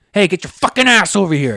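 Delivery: mock dad yell. Dad showing when to swear, a mock yell. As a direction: bark it like a dad who means business.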